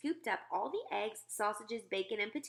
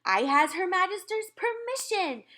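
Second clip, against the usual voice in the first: much higher, loud triumphant cry; gleeful giant; giant cries out; 11 semitones higher, 10 dB louder than usual. Acted. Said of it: "cried the B F G".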